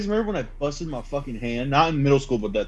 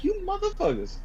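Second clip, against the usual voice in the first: mock outrage; 7 dB quieter than usual. Retold cursing after wasted trouble.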